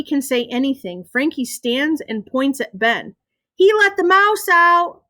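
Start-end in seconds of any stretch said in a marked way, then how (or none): 3.5-5.0 high, loud gleeful tattle; happy tattling. A narrator's reading. Frankie points at Ben before the teacher, happy. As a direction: tell on him with delight. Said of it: "Frankie sounds happy".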